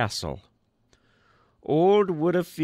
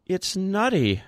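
said faintly; 9 semitones higher than usual. A narrator's reading. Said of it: "and then said faintly".

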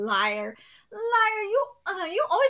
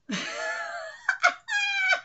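a long, high shriek of laughter. Real, not acted. After his mud wrestling joke, shrieking with laughter. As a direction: let out a squealing cackle.

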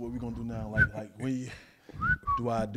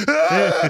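1 mock flashback scream; 10 semitones higher, 9 dB louder than usual. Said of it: "You're like".

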